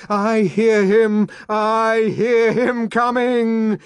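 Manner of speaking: slow, high, loud chant; loud stretched-out ecstatic chant; unison shouting. A narrator's reading. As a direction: shout it rhythmically like a frenzied crowd. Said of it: "shouting in unison".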